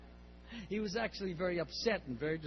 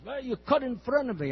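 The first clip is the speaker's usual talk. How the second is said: put-on Russian accent; 7 semitones higher, 10 dB louder than usual. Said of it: "I'll imitate a little bit to him".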